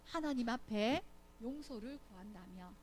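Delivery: ordinary talk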